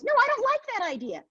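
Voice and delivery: put-on high, sharp kid's refusal; protesting girl. Sister rejecting her brother's idea, high and sharp. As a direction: shoot the idea down like a stubborn sister. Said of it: "and she goes".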